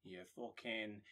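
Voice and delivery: slow, quiet Mancunian drawl; softly slurred, sweary Manchester rock-singer voice. Acted. Host as rock singer handing out spoils, Mancunian impression. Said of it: "[name] in my head".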